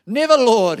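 rebuking. A narrator's reading. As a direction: rebuke him forcefully. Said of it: "began to rebuke him".

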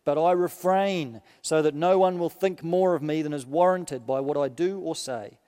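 measured, solemn reading of Paul's letter; preacher reading scripture aloud. Acted. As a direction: read it out to the congregation with weight. Minister reads Paul's letter aloud.

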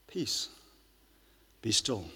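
soft, settled calm; hushed steady peace; quiet confidence. Shown as a demonstration. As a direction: let it fall gently and assured. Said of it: "Just have that quiet confidence in God".

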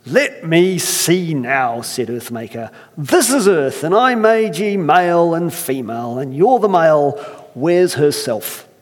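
slow, weighty Irish brogue for Earthmaker; Irish accent. Acted. Earthmaker questioning first man in an Irish accent. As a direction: speak like a stern Irish elder. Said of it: "I can't help but try it".

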